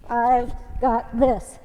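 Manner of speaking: "I've got this" stretched slow, each word on a beat; slow rhythmic mantra. Shown as a demonstration. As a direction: chant "I've got this" slowly in time with the reps. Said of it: "Gives you a little rhythm".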